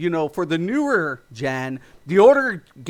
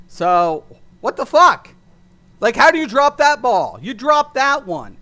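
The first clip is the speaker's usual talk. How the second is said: louder, higher exasperated rant; furious disbelief at the developers; angry outburst; 4 semitones higher, 6 dB louder than usual. Real, not acted. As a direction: blast the devs for blowing it.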